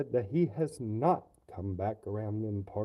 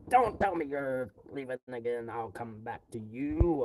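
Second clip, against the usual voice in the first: normal talking voice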